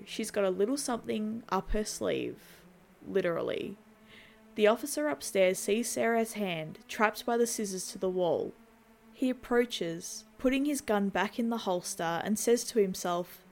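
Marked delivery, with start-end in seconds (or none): none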